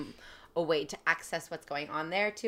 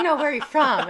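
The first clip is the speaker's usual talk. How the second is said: higher, louder mock doorman pressing the question; insistent doorman; 9 semitones higher, 11 dB louder than usual. Retelling the pushy doorman to friends, a laughing mock insistence.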